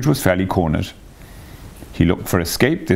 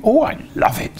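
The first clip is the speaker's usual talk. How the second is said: high washerwoman gush. A narrator's reading. Toad squeaks.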